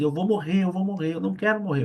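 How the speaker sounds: a mild mock of crying out in fear; tearful pleading. Weeping man pleading he doesn't want to die. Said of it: "ele foi chorando".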